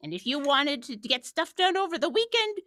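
lightly voiced cranky grandparent; grumpy old-timer. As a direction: grumble like a hint of an old-timer. Grumpy old-timer bit, back in my day.

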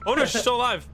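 high-pitched burst of disbelief; excited surprise. Real, not acted. Player seeing a teammate survive after all, excited surprise. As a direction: correct yourself in sudden excitement.

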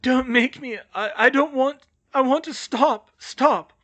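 high, loud, frightened pleading; protesting. A narrator's reading. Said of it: "could hear Bill protesting".

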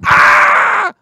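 high, loud startled scream; mock scream. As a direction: yelp as the cops burst in. Gamer startled by police.

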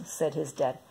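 plain reading voice

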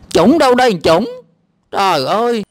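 loud, high-pitched challenge; exasperated outburst. Real, not acted. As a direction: pounce on the wrong word, indignant. Indignant at the wrong word.

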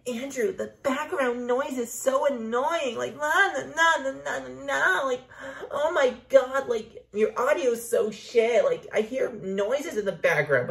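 whiny complaining imitation; mocking whiny friend. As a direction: mimic an annoyed friend's complaint. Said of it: "it's like".

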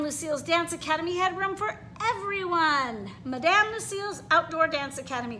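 lively storybook read-aloud with character voices; reading to children. A narrator's reading. Teacher reading a picture book to her class, animated. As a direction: read it like story time for little kids.

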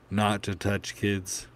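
ordinary delivery, nothing marked

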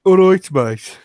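hammed-up Birmingham accent; Brummie accent. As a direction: put on a hearty Brummie accent. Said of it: "you've got to be a Brummie then".

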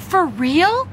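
astonished, pitch swooping up; amazed. Acted. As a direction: ask it in happy disbelief. Boy amazed at the surprise.

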